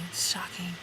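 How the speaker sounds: mock shocked whisper. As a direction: whisper it like a scandal. Mock shocked whisper, then joke.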